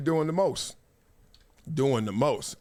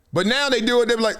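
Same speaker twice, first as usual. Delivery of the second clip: ordinary delivery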